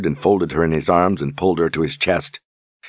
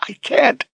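high, broken "I can't"; anguished cry. A narrator's reading. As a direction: cry it out in misery. Berdine in misery.

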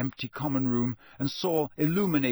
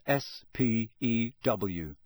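usual speaking voice

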